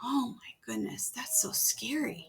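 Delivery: hushed scared whisper. Real, not acted. Reader to the listening child, a hushed whisper.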